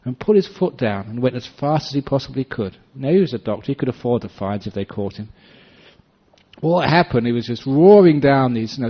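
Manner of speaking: ordinary talk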